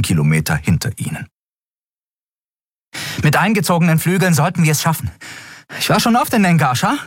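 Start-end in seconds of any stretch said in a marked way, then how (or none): 3.2-7.0 fast, high pilot voice, sure of herself; confident pilot. Acted. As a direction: rattle it off like a daring pilot. (schnelle, hohe Pilotinnenstimme, selbstsicher)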